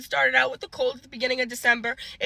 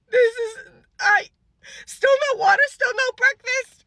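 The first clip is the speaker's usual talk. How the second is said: higher, strained voice close to tears; voice breaking; 11 semitones higher, 5 dB louder than usual. Real, not acted. Telling the nurse she's had nothing all day, voice cracking high. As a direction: push the words out as the tears come.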